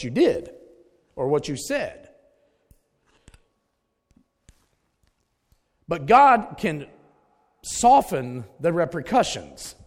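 loud, driving preacher voice; fiery preaching. Acted. Pastor telling the church to face consequences, loud and fervent. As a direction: preach it hard to the congregation.

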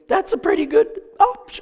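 squeaky comic emphasis; loud, high-voiced comic aside; high-pitched understatement. Real, not acted. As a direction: jump way up high for the obvious point. Comic understatement about big break.

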